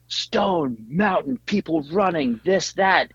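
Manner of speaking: high, slow, eager rush of plans; gushing with excitement about the idea; excited. Retold or imitated. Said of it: "we all got all excited again".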